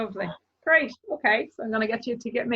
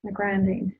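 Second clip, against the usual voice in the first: guided meditation. Acted. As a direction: guide the listener calmly into their body. Leads a closing meditation.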